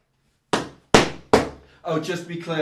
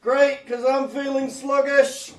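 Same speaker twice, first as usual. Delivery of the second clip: high, slow, loud slug comic voice; slug comedian; 10 semitones higher, 6 dB louder, slower than usual. Acted. Slug comic telling the room how it feels, slow high delivery. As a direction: draw the punchline out like a slug. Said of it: "if a slug did comedy".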